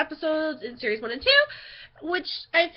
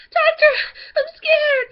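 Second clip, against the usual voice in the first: very high, loud mock-scared squeal; exaggerated frightened whine; freaked out; 10 dB louder, slower than usual. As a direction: cry out to the doctor in hammed-up panic. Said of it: "I was, like, all freaked out".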